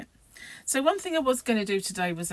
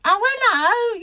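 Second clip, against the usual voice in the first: very high, loud mock telephone greeting; hammed-up phone hello, pitched right up; exaggerated phone voice; 11 dB louder, slower than usual. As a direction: send up mum answering the phone. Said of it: "what is the phone voice all about?".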